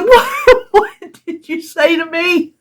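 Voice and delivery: high, laughing disbelief; incredulous laughing. Retold or imitated. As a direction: laugh out your shock at the request.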